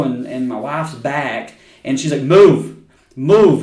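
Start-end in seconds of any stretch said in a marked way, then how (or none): 2.2-3.6 loud, clipped mock Russian "Move, move"; imitating Russian accent. Imitating the Russian woman shoving, loud mock accent. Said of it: "she's saying it like fucking".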